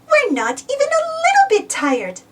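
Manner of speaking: put-on high little-girl protest; a piglet insisting she's not tired; Peppa crying out. A narrator's reading. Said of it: "cried Peppa".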